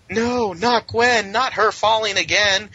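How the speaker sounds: high, very slow anguished wail; mock melodrama. Host mocks the heroes wailing as Gwen falls, high and slow. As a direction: ham up the tragic cry, stretched out. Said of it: "and they're like".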